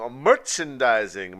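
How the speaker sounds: loud, slow announcement; announcer voice. Acted. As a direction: call out the segment title. Announcing segment title.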